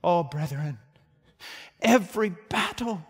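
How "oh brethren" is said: ordinary talk, nothing marked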